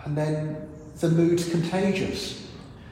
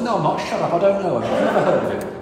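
higher, louder flustered denial with laughs; acted denial; 4 semitones higher, 4 dB louder, faster than usual. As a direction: brush them off, rattled. Peter denies Jesus, acted.

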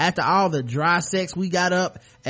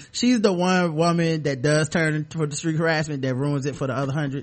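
ordinary delivery

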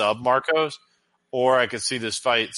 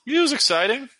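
higher, louder repeat for clarity; 4 dB louder than usual. Real, not acted. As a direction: say it again, raised and louder. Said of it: "What's that?".

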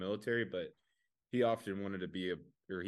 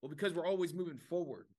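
higher, confident made-up reason; fake soldier excuse; 7 semitones higher than usual. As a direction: deliver the nonsense proudly, pitched up. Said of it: "He's like".

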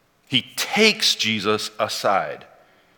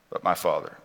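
softer, lower repeat of "But my Father"; quiet echo; 6 semitones lower, 7 dB quieter than usual. Shown as a demonstration. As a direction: let "But my Father" sink into a hushed echo.

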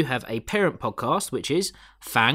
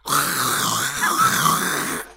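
breathy monster screech; unvoiced hissing creature noise; Demogorgon impression. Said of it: "can we have a Demogorgon impression?".

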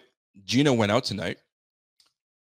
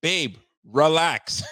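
slow, louder mock calming; drawn-out soothing; 5 semitones higher, 7 dB louder, much slower than usual. Husband mock-soothing wife, slow and drawn-out. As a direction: stretch it out like soothing a partner. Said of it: "what you want me to say".

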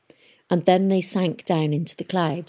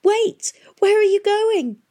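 high, loud call after her; boy shouting after the girl walking away; 5 dB louder, slower than usual. A narrator's reading. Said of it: "shouted Tom".

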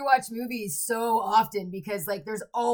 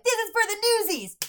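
high, fast excited cry; excited shout; 11 semitones higher, 3 dB louder, faster than usual. Retold or imitated. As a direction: call it out, thrilled.

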